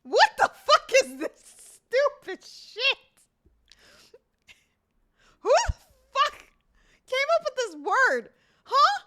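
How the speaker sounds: high loud exasperated ranting; real furious rant at a word; angry rant. Real, not acted. Rants at the word.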